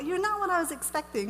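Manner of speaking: high put-on voice of a puzzled stranger; surprised onlooker. People meeting her, imitated in a high surprised voice. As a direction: mimic someone taken aback. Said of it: "I think people are like".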